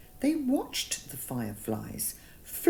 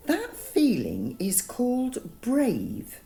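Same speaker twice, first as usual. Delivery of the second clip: reassuring; 7 semitones higher, 4 dB louder, slower than usual. Acted. Strawberry reassuring, slow.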